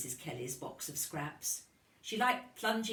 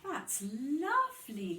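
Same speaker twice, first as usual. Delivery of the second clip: pleased teacher; 7 semitones higher, slower than usual. A narrator's reading. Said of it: "said Missus Kelly".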